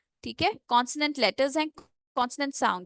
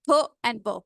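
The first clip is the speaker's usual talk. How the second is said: normal talking voice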